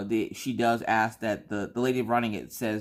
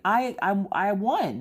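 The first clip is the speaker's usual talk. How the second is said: excited; 9 semitones higher, 4 dB louder, slower than usual. Retold or imitated. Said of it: "I'm like".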